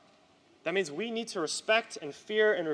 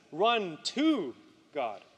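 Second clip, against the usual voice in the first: "to" stretched out and punched; stress on to; much slower than usual. Shown as a demonstration. Preacher contrasting running away with running toward, stress on "to". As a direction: lean hard on "to", then pause before "God".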